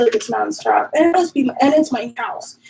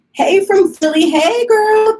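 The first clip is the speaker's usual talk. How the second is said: excited greeting; 8 semitones higher, 6 dB louder, slower than usual. Real, not acted.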